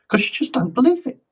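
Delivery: high and loud, excited. Real, not acted. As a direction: raise it up with excitement. Excited about the mirror exercise.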